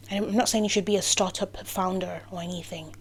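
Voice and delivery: usual speaking voice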